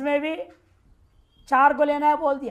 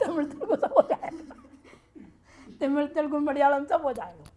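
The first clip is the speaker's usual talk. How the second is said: laughing; 2 semitones higher than usual. Real, not acted. Laughing punchline of guru's joke.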